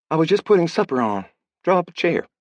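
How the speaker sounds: put-on girl asking him to eat; young woman. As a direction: offer supper kindly. Girl voiced inviting.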